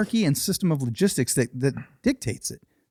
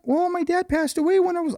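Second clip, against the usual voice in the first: put-on high, twangy Wisconsin-like accent; strange twangy accent; 13 semitones higher than usual. Customer explaining her twang, strange high accent. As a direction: imitate her odd accent, pitched way up. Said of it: "Do they have an accent?".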